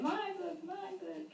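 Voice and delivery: put-on child voice. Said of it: "She always has to read".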